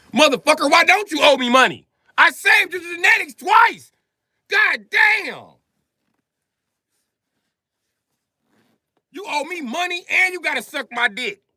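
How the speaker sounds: very high, loud outraged yelling; furious shout. Real, not acted. Ranting at his opponents over money, in a very high furious shout. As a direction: scream at them that they owe him.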